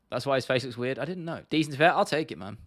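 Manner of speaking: usual speaking voice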